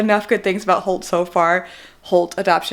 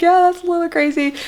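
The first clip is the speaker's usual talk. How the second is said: retold high-pitched reply; 8 semitones higher than usual. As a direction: act out agreeing in a high voice. Acting own reply to husband.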